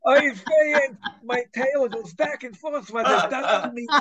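put-on high, loud, kvetching Yiddish accent, slowed down. Talking dog kvetches to its owner, in a loud, high Yiddish accent. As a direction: groan it out like an old Yiddish complainer. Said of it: "Do the accent".

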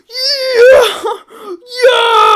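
high, very loud cheer; excited shout. Real, not acted. Gamer spotting a frog, excited shouting. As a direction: whoop it out at full volume.

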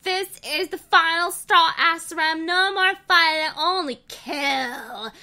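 high, loud vow, last words slowed; furious vow. Acted. Heroine vows to kill the villain, furious. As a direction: declare vengeance with burning fury.

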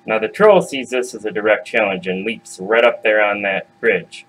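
ordinary delivery

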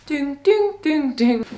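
timer ding imitation. Imitating a timer bell when done.

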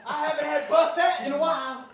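loud, high, laughing wail; hammed-up excuse. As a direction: holler a mock confession through laughter. Acts out jokey excuse, laughing.

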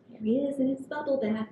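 ordinary delivery, nothing marked

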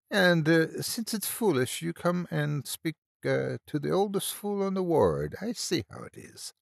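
high, lilting mock-offended old lady; teasing old Irish nurse. A narrator's reading. Old nurse chides the lad for calling it foolish, mock-offended. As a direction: pitch it well up, as if wounded.

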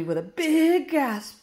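plain reading voice

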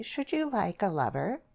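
high, sugary question; sweetly. A narrator's reading. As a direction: ask her oh so sweetly. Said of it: "she asked sweetly".

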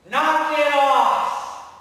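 loud, drawn-out command to the storm; slow, booming order to stop; commanding shout. Acted. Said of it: "lifts up his hand".

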